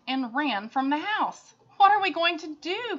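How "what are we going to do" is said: higher, louder worried cry; a mother crying out in despair; distressed cry. Acted. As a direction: cry out helplessly. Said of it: "cried Missus Cream".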